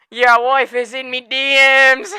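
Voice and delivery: slow, high, loud mock Irish jeer; mock Irish taunt. Conor taunting Dustin about his wife, loud mock Irish jeer. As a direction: sneer it out in hammed-up Irish. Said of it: "And then Conor just".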